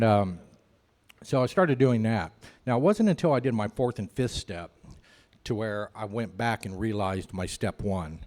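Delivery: ordinary delivery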